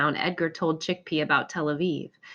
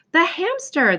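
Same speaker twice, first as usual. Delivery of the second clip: high, louder delighted cry; excited cry; 10 semitones higher, 5 dB louder, slower than usual. Acted. Boy spotting his lost hamster, crying out with delight. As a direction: burst out with joy at the news. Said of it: "the boy cried".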